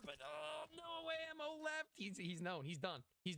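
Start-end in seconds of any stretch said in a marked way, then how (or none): 0.1-1.9 oh shouted much higher; excited shout. Real, not acted. Caster reacting to empty ammo, sudden high shout. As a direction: yell it out in surprise.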